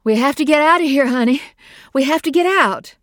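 loud, high, fast urging; urgent pleading. Acted. Mother urges her daughter out of the rising water, urgent. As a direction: hurry her out, pressing hard.

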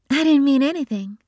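brushing off the accusation; innocent-sounding sister; defensive Bree. A narrator's reading. Bree voiced by narrator.

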